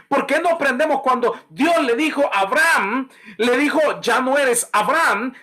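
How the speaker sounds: fiery preaching. Acted. Pastor urging the congregation in a fiery sermon. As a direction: preach it out high and hard to the crowd.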